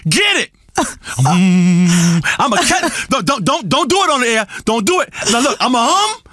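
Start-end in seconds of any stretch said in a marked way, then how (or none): 0.8-2.2 deep hum. Acted. Guru hums on air.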